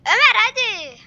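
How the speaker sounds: loud call to a friend. Real, not acted. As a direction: shout to hurry him up. Calling his friend to hurry.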